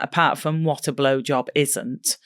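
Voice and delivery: usual speaking voice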